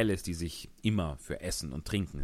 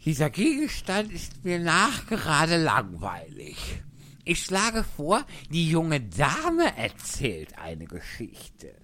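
loud, slow, bored hare voice; March Hare; 9 semitones higher, 5 dB louder, slower than usual. Acted. Faselhase als Figur. (gelangweilter Faselhase, laut und langsam)

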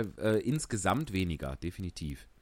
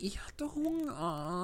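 slow high whisper; whispered confession; 7 semitones higher, 9 dB quieter, slower than usual. Acted. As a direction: breathe it out ashamed, slowly. Pinocchio confessing.

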